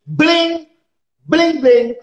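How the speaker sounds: high-pitched mimicked bell rings; ringing alarm bells. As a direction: ring out each word like an alarm. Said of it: "the bullshit bells are just ringing".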